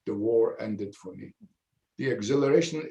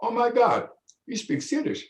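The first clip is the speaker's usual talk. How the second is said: higher-pitched astonished exclaim; boy hearing his own language, higher and amazed; retold amazement; 10 semitones higher than usual. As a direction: gasp it out in disbelief. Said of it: "in total amazement".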